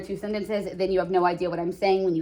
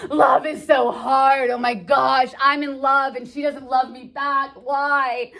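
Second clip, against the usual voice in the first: louder, higher mock wail about love; lovesick complaining; 4 semitones higher, 3 dB louder, slower than usual. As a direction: moan it, drawn out and hammed-up. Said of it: "he's just gonna kind of go on and on about".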